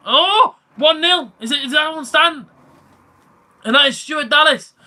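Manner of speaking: high, loud burst into goal commentary; excited goal call. Acted. Streamer reacting to Leeds scoring for viewers, excited goal call. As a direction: shout the goal as it goes in.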